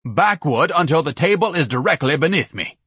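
anxious, haughty calling out; pompous Ignatius, nervous. Acted. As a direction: direct the carriers with nervous pomposity. Ignatius directs his carriers.

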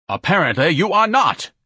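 high, loud, indignant retort; affronted and imperious; pompous Ignatius. Acted. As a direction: contradict them with haughty outrage.

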